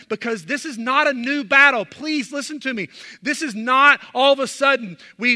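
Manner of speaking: loud, high fervent sermon delivery; full-force preaching. Acted. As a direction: press the congregation hard, pleading.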